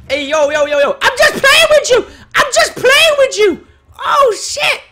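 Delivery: high, very loud frantic yelling; panicked shouting. Real, not acted. Player pleads he was just playing, frantic high shouting. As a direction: yell it out at the top of your voice.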